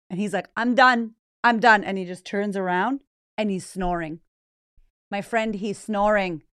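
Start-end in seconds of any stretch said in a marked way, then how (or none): 0.6-1.8 loud, exasperated dismissal; fed-up husband. Retold or imitated. Husband ending the argument in bed, loud exasperation. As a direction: cut her off, loudly fed up.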